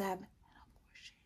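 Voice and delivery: hushed, voiceless whisper. Retold or imitated. Delicately naming the option to viewers, whispered. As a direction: drop to a whisper on the taboo words. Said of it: "why I whispered it the first time".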